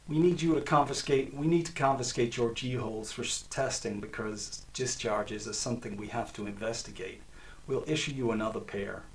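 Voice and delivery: lightly voiced Ivy League American agent; a hint of a clipped-free American agent accent, unhurried; a hint of a menacing American agent's Ivy League accent. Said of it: "complete with Ivy League American accent".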